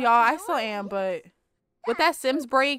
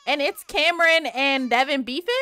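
ordinary delivery

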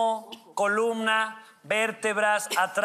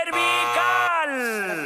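booming slow game-show clue; drawn-out clue; 5 semitones higher, much slower than usual. Acted. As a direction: stretch Cervical out for the players. Host shouts clue word slowly.

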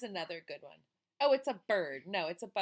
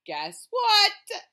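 high, loud, eager question; excited teaser; 12 semitones higher, 15 dB louder than usual. Acted.

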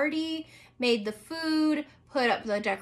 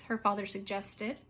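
plain reading voice

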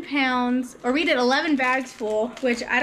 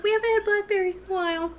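put-on little girl voice; 7 semitones higher, faster than usual. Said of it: "she's like".

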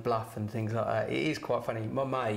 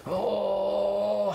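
loud, high, drawn-out mock kung fu cry; imitated karate scream; 8 semitones higher, 5 dB louder than usual. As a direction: let out a hammed-up martial arts scream. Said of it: "screaming like that".

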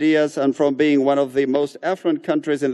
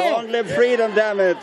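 raised pitch chant; rally cry; 7 semitones higher than usual. Acted. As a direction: echo the chant up high. Interpreter echoing chant. (consigna gritada)

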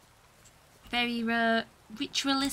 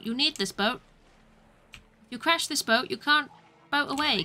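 normal talking voice

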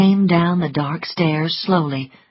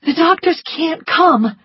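higher urgent woman's voice; urgent plea to the maid; Scarlet voice; 11 semitones higher, 3 dB louder than usual. A narrator's reading. Scarlet urging Prissy.

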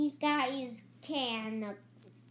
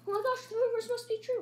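shocked; 10 semitones higher, much faster than usual. Acted. Discovers pet killed.

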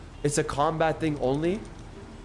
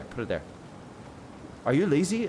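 usual speaking voice

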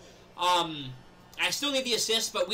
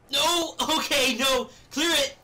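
high, loud yells to clear the ball; urgent yell; 7 semitones higher, 6 dB louder, much slower than usual. Real, not acted. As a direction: bark at the team to clear it. Yelling during defence.

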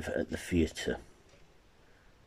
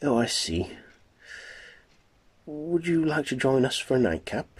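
put-on much higher, breathy-free young woman voice, teasing; 8 semitones higher, faster than usual.

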